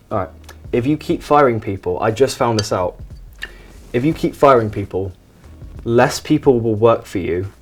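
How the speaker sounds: ordinary talk, nothing marked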